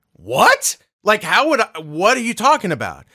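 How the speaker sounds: reply as if blindsided by the accusation; retold incredulous outburst; acted disbelief. Acted baffled reply to scolding.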